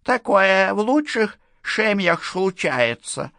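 slow, high, slurred toothless lisp; drunk lisping old woman. Acted. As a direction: lisp it like a tipsy old widow. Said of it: "добавила она". (пьяная шепелявая старуха)

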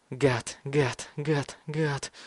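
hushed obsessive repetition; whispered mantra. A narrator's reading. Mad boy rocks with his knife, whispered mantra. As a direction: whisper each word like a chant. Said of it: "шептал теперь Бен".

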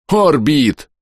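высокий возмущённый выкрик; заори на нерадивого эльфа; возмущённый вопль. A narrator's reading. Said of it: "В негодовании завопил я". (возмущённо завопить, выше)

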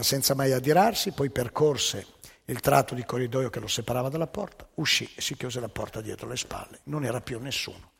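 ordinary talk

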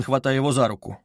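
ordinary delivery, nothing marked

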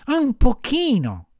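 high, slow marmot voice; high-pitched obliging animal voice; little marmot. A narrator's reading. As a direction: pitch it up and loud, stretching the words.

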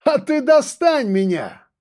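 slow, high, taunting voice; mocking. A narrator's reading. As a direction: taunt him from the dark. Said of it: "насмешливый голос маньяка".

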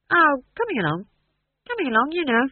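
high, airy brush-off; evasive. Acted. Playwright fobbing boss off about his play, evasive. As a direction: dodge it, pitch up then falling away.

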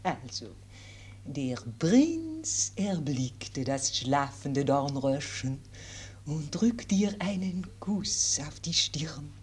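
put-on gentle governess with French accent. French governess tells fairy tale.

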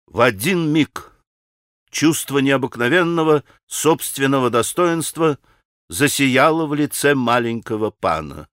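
plain reading voice

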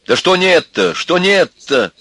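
high, loud challenge; sudden anger. A narrator's reading. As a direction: snap back at your father. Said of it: "рассердился вдруг Костя на отца". (сердитый громкий вызов)

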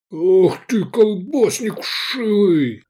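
high, drawn-out angry yell; thundering. A narrator's reading. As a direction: thunder the insult at an enemy. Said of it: "гремел Децим". (гремящий протяжный крик)

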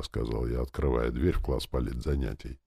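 plain reading voice

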